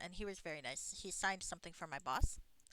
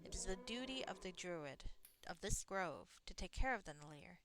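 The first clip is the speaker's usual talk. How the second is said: put-on soft, lightly accented reading of the game's lines; lightly voiced game dialogue; 8 dB quieter than usual. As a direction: read the druid's lines softly with a hint of an accent.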